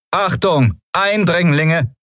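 high-pitched robotic warning, drawn out at the end; stiff, high automated alert; rasping machine alarm. A narrator's reading. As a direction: announce it like a security machine. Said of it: "schnarrte die Kugel".

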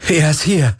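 high, elated exclamation; jubilant. A narrator's reading. Galen cheers to Kirai that his friend is found, high and jubilant. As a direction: cheer the news out. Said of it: "jubelte Galen".

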